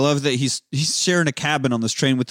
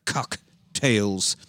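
"cock" drawn out and split from "tails"; stress on cock; 4 semitones lower, 3 dB quieter than usual. Retold or imitated. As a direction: linger on "cock" in "cocktails", pause before "tails". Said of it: "They emphasize cock so much".

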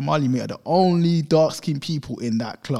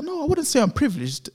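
high-pitched mock protest; imitated woman, pitched way up; mimicked American woman; 13 semitones higher than usual. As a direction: deny it in her high voice. Woman denies being privileged.

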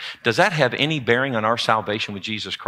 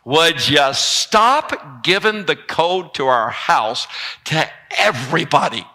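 slow, drawn-out scolding as the wife; mock-exasperated wife pleading; 3 semitones higher, slower than usual. Wife scolding husband over sharing the house code, slow and exasperated. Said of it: "Kelly's like".

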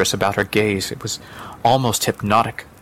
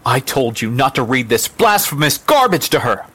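raised, indignant mother's voice; angry scolding; 4 semitones higher, 4 dB louder than usual. Acted. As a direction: scold with rising force.

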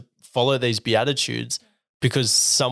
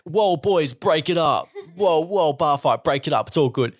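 bar fight breakup; 5 semitones higher than usual. Retold or imitated. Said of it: "I don't think of this as like".

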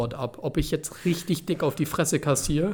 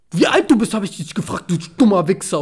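loud, higher, aggressive snarl of the man; retold furious yell; 3 semitones higher, 6 dB louder than usual. Stranger yells at teen demanding his age, loud and angry. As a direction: bark it like a thug losing it. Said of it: "Er ist so richtig eskaliert, so mit seiner Stimme".

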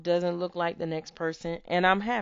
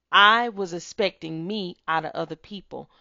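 normal talking voice

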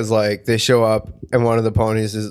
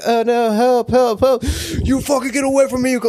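much higher, fake damsel panic; mock cry for help; 11 semitones higher than usual. Friend retells a London video, woman fakes a panicked cry. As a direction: squeal for help like a cartoon victim. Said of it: "she's like".

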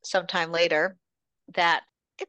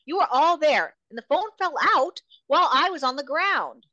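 heated accusation; 10 semitones higher, 4 dB louder, faster than usual. Retold or imitated. Rika accusing the group.

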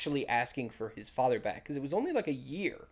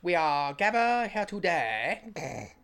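slow, solemn mock vicar; lightly voiced clergyman; 3 semitones higher, 4 dB louder, slower than usual. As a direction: drag it out like a wedding sermon. Imitating the clergyman's wedding speech.

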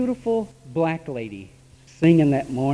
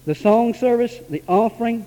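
ordinary delivery

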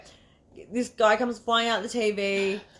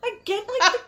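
high-pitched giggling through words; laughing while telling it. Real, not acted. Laughing recounting absurd scene.

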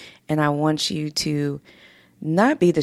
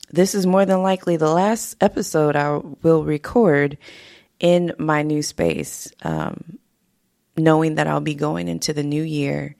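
normal talking voice

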